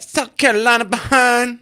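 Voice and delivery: exaggerated put-on Southern twang shouted back; mocking Southern drawl. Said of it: "what is that?".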